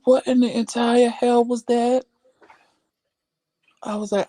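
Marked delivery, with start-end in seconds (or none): 0.0-2.0 high, incredulous replay of shock; retold disbelief. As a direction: re-enact the scare in a raised pitch. Re-enacts shock at noise.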